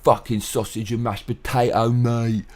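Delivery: mock English lad, lightly voiced; lightly voiced English bloke mocking the diet. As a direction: do a cheeky jab at a typical Brit. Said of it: "it's like".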